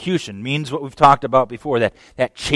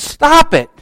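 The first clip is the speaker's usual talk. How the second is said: higher and louder sharp rebuke; firm raised "Stop it"; stern command; 14 semitones higher, 5 dB louder, slower than usual. Acted. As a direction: snap them out of it. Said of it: "He says".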